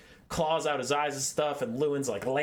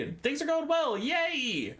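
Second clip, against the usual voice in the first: high-pitched celebratory yay; giddy high cheer; excited yay; 13 semitones higher, slower than usual. Acted. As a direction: squeal it up high in mock triumph. Celebrating the going-well moment.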